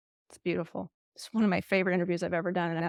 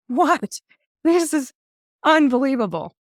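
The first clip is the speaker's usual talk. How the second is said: astonished; 6 semitones higher, 6 dB louder, slower than usual. Retold or imitated. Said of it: "and I just sat there like".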